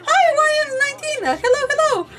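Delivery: high, loud cheery hello; big bubbly high-pitched hello; excited greeting. Real, not acted. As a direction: call out a delighted welcome to a newcomer. Greets a viewer joining the stream.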